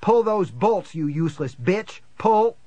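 loud, high furious yelling; frantic shouted command with abuse; screamed order. A narrator's reading. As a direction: yell at her to act, urgently. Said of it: "I screamed at Diane".

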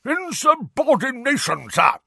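high, loud bellow; roaring. A narrator's reading. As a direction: roar like an outraged old soldier. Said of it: "roared a corpulent, red-nosed wizard".

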